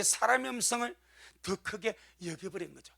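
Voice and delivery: ordinary talk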